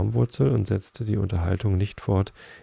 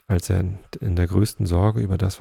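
usual speaking voice

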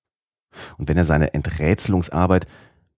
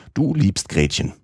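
a stern guardian's reproach, pitched up; 7 semitones higher than usual. A narrator's reading. Uncle confronting his nephew about Gretchen, stern guardian tone. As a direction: catch the boy out like a strict guardian. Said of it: "in echtem Vormünderton".

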